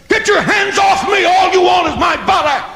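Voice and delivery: high mock shout, snapping; imitating a furious wife's shout; snapping like a junkyard dog. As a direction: bark at him to back off. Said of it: "she snaps at him like a junkyard dog".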